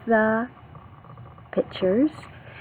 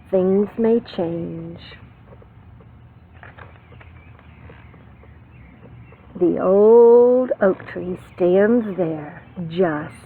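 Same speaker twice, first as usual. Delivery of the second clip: slow ending; 2 semitones lower, slower than usual. Acted. Slow story ending read aloud.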